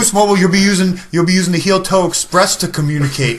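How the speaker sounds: loud, high, rapid comic tirade; mock-furious shouted taunt; mock threatening rant. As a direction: keep the hammed-up rant rolling. Continuing the comic phone threat.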